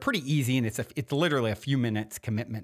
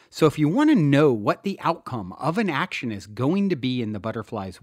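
louder, emphatic self-help book reading; earnest audiobook narrator, a little louder than his chat; audiobook narration; 4 semitones higher, 6 dB louder than usual. Acted. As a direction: read it to the listener like an inspired author narrating his own book. Reads chapter of his book.

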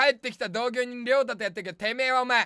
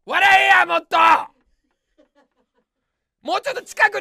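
roaring at them to laugh, much louder and higher; mock-angry shout; 6 semitones higher, 11 dB louder than usual. Comedian shouting at the audience to laugh more, mock-angry yell. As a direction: yell the demand at full force.

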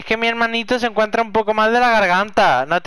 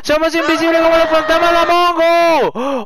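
excited; 8 semitones higher than usual. Real, not acted. Excited about being invisible.

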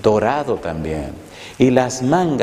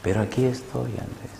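softer, tender reassurance; a touch of a tender whisper; 3 semitones higher, 8 dB quieter than usual. Retold or imitated. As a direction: gently reassure him. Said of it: "esa voz me seguía hablando".

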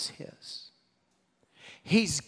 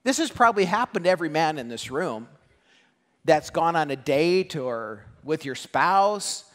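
ordinary talk

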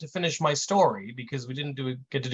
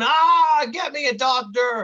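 loud, raised cry of pain; mock scream; 10 semitones higher, 7 dB louder, slower than usual. Scalded agent wanting a doctor, mock scream. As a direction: holler it, hammed-up agony. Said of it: "still screaming his fool lungs out".

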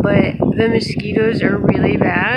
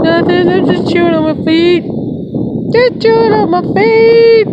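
dog's voice; 10 semitones higher, 4 dB louder than usual. Pretend. Voicing the dog's answer.

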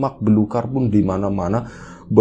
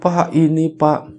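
high, loud panicked gasping; terrified; 7 semitones higher, 5 dB louder, slower than usual. Retold or imitated. Yogas panicking to the villagers, high and loud. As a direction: struggle to get words out in fright. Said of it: "shock, takut banget".

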